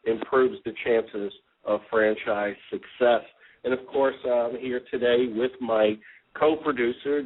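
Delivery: ordinary talk, nothing marked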